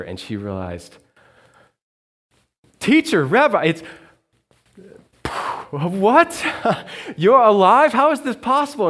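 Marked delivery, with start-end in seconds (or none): 2.8-8.9 much higher and louder, breaking into a laugh; stunned joy spilling out as she recognizes him; Mary suddenly recognizes Jesus, shrieking with joy; overjoyed disbelief. Acted.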